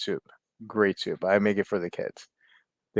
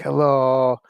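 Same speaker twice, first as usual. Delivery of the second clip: mock Italian greeting, raised and louder; Italian accent; 4 semitones higher, 6 dB louder than usual. Kid pen pal writes hello from Italy, Italian accent. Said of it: "read it in his Italian accent".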